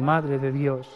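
ordinary talk, nothing marked

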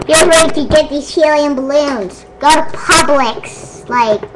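ordinary delivery

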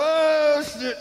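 high, loud mock scream; panicked yelp. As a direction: shriek it like a startled victim. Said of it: "they go".

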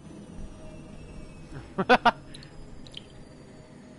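airy drawn-out laugh; breathy laugh. Real, not acted. Laughing at the game.

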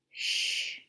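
soft shh; hushing shush. Acted. Monkey hushing the others after a crash, soft shush. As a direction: hush them quietly after the noise.

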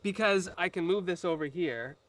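ordinary delivery

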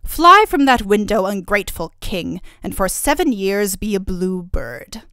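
loud proclamation of a spell; commanding curse. A narrator's reading. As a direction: pronounce the curse with force. Fairy curses the king.

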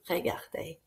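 hushed regardez to draw them in; whispered regardez. Shown as a demonstration. Teacher hushing the class to look at her. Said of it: "You could say".

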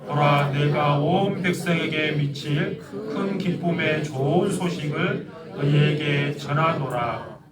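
ordinary delivery

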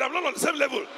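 believing declaration; said like you believe it. Shown as a demonstration. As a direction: claim it as true. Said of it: "Say it like you believe it".

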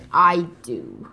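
low, falling reply; reluctant promise. Acted. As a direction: concede it in a low mother fox voice.

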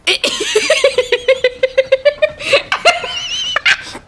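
high wheezing cackle; long laughing fit. Real, not acted. Gamer cracks up at the game, long high laugh.

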